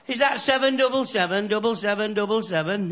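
high, bright telephone voice; caller on phone. Acted. Stuck caller phoning a stranger, voice high. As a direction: pitch it up like a caller on the line.

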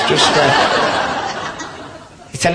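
normal talking voice

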